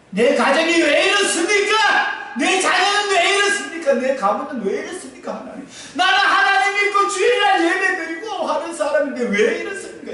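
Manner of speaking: high, loud pleading shout; shouted lament. Shown as a demonstration. Preacher showing the congregation how to cry to God, shouting high.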